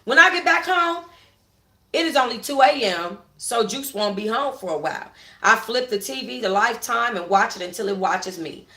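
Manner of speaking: ordinary delivery, nothing marked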